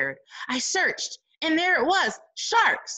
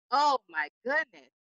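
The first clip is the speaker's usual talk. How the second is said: plain reading voice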